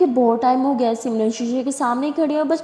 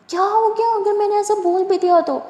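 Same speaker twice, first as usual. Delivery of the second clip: exasperated; 8 semitones higher, 4 dB louder than usual. Acted. Durga pleads with crying friend.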